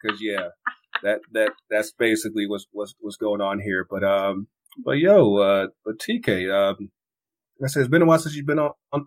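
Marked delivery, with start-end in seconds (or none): none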